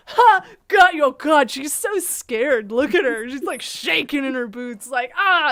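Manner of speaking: loud, hammed-up imitation of crowing; mocking gloater. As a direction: crow over a victim, mockingly exaggerated. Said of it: "and they'll go".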